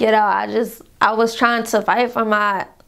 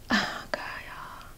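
breathless whisper, holding back real tears; whispered, near tears; 15 dB quieter than usual. Real, not acted. Pausing her story to viewers, whispering near tears. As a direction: barely whisper it through the lump in your throat. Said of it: "Sorry, I'm not trying to cry".

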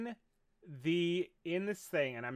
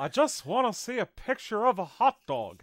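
slightly raised cartoon Texan voice; performed Southern cartoon impression; King of the Hill voice; 4 semitones higher, 4 dB louder than usual. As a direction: deliver it as a cartoon Texan catchphrase. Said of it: "Can you say".

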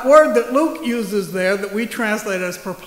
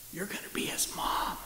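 hushed secret whisper; 2 semitones lower, 15 dB quieter than usual. Acted. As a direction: breathe it like a huge secret. Angel reveals the news.

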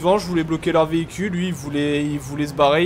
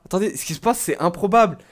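usual speaking voice

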